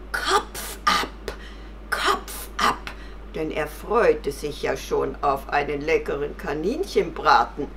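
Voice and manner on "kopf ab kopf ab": high, partly voiceless fox voice; sly fox. Acted. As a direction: demand it with hungry relish. Fox demands its punishment. (listiger Fuchs mit hoher Stimme)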